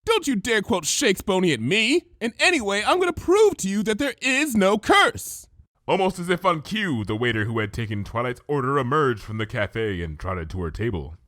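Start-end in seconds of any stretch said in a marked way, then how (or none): 0.0-5.5 higher, louder protest; Twilight, mildly indignant; indignant outburst. Acted. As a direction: snap back in exasperation. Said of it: "Twilight stamped her hoof".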